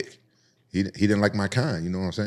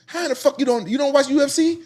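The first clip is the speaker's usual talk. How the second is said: much higher and louder, disbelieving; incredulous hype; 6 dB louder than usual. Retold or imitated. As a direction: hype up the champ in shock. Teammate in disbelief.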